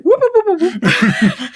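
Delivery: high, loud hammed-up whoops; mock panicked whooping. Fleeing villain hammed up with panicked whoops. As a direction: yelp it like a cartoon coward running.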